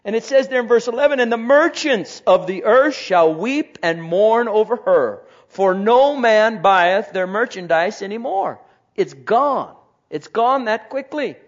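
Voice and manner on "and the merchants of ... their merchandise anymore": reading Revelation out loud with force; scripture reading. Acted. Preacher reading scripture to the congregation, loud. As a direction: proclaim the verses from the pulpit.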